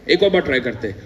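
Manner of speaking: usual speaking voice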